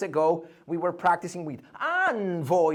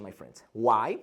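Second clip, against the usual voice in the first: ordinary talk, nothing marked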